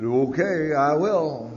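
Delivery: an unexpected yes that surprises even the speaker; strange voice. Retold or imitated. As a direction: let a surprising yes burst out. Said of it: "I heard the strangest noise coming out of my mouth".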